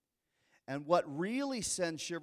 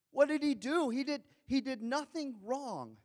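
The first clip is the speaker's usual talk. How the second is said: much higher, loud plea; preacher echoing Pilate, high and pleading; pleading cry; 10 semitones higher, 5 dB louder than usual. Acted. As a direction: cry out the question.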